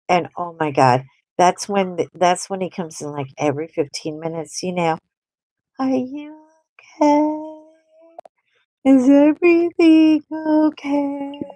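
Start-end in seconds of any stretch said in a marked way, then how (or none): 5.8-11.4 higher, slow pleading husband voice; mock needy husband. Wife imitating husband checking in, needy. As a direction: ask anxiously like a clingy spouse.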